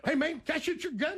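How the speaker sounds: high-pitched, eager replay through laughter; imitating a rowdy singer. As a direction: ask like an overexcited rocker, amused. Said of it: "and he goes".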